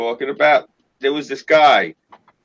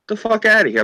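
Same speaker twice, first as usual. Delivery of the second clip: disbelief; 10 semitones higher than usual. Retold or imitated. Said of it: "They're like".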